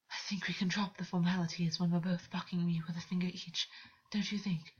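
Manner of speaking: breathy amused whisper; breathless. Acted. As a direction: say it half-laughing, out of breath. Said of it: "August laughed breathlessly".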